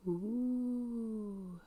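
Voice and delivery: playful haunting wail; ghost wail. Pretend. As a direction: howl a drawn-out ooh like a ghost. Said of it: "acting like a ghost".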